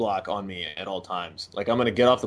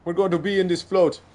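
high, loud put-on Trinidadian accent; imitating a Trinidadian friend's accent; Trinidadian accent impression; 8 semitones higher, 6 dB louder than usual. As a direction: brag about the float in a Trinidadian accent. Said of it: "when I try to do an Island Times accent".

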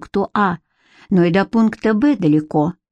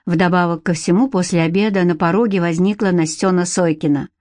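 ordinary delivery

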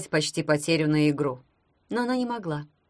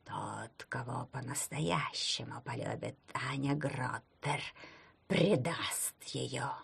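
much softer, slow rustling whisper; wind spirit; 5 semitones lower, 11 dB quieter, slower than usual. A narrator's reading. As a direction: breathe it out like wind through leaves. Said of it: "бесплотный голос прошелестел".

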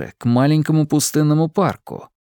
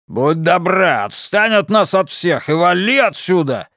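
snarling; 5 semitones higher than usual. A narrator's reading. Monteyn telling Anna to clear off, angry. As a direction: growl at her to get lost. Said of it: "зарычал Монтейн".

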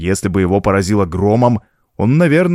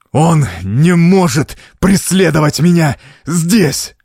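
slow, higher, anguished outburst; frantic raving; 6 semitones higher, 4 dB louder, slower than usual. A narrator's reading. Count with mad eyes beating the girl, frantic. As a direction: wail it out word by word.

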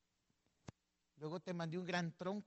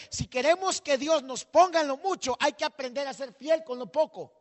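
predicación fuerte; 10 semitones higher, 15 dB louder than usual. Shown as a demonstration. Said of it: "Diga conmigo".

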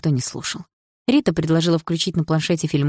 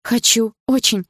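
tender; 5 semitones higher, 5 dB louder than usual. Acted. Tender answer to lover.

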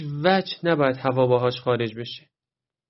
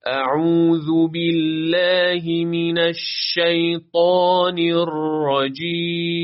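slow, melodic, chanted recitation; Quran recitation; 3 semitones higher, 3 dB louder, much slower than usual. Shown as a demonstration. Recites the full isti'adha.

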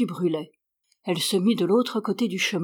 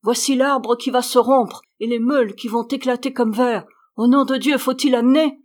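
louder, higher, pleading shout; frightened shout; 4 semitones higher, 6 dB louder than usual. A narrator's reading. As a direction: yell in fear over the storm. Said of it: "s'écria le meunier".

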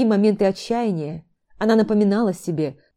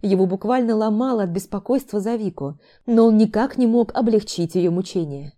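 usual speaking voice

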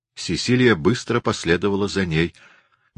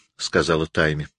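usual speaking voice

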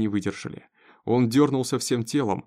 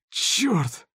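high, strained groan of pain; groaning curse; 9 semitones higher than usual. A narrator's reading. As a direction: curse through sudden pain. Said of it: "простонал Беннет". (стон от боли)

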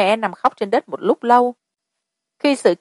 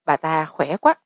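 ordinary delivery

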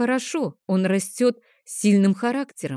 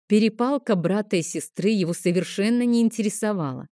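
plain reading voice

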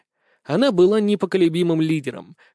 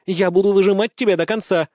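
raised, louder determined vow; insistent; 5 semitones higher, 5 dB louder than usual. A narrator's reading. Girl vows to keep pursuing the boy, insistent. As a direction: press on stubbornly. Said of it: "настойчиво ответила Слуцкая".

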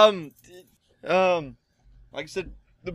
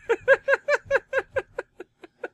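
high squeaky laugh. Real, not acted. Laughing at own bad accent.

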